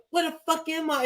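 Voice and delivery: high-pitched put-on kid voice; young kid. Kid lost in the game, high mock-child voice. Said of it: "he'll say some stupid shit like".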